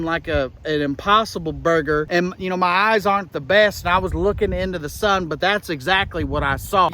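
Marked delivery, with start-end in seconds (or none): none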